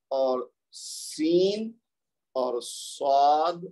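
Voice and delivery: sounding out seen and saad slowly; slow letter names. Shown as a demonstration. Teacher sounding out سین and صاد for the class. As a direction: draw out سین and صاد one by one. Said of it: "یہ تین ہو گئے جی".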